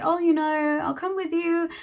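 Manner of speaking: high, sugary mock friend voice, pitched well up; imitating May. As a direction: mimic her empty promises in a lifted voice. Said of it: "May had said".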